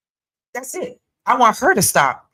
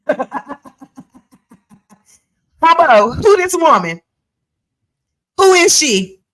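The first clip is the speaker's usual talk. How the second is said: higher, louder, yelling at Harpo in a put-on Jamaican accent; mock Jamaican accent; 3 semitones higher, 3 dB louder than usual. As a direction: demand an answer in a hammed-up Jamaican accent. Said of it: "I wish I had a good Jamaican accent".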